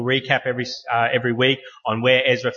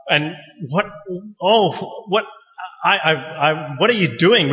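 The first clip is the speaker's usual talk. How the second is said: stammering in disbelief, voice jumping up; shocked stammer; 5 semitones higher than usual. Retold or imitated. Supervisor walking in on a student botching a toenail, aghast stammer. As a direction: catch the student mid-blunder, aghast. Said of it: "I came back in and was like".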